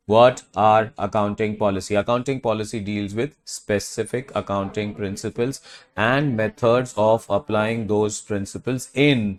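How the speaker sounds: very slow, measured definition recital; slow dictation. Shown as a demonstration. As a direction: dictate each phrase slowly to the class. Recites the definition slowly for class.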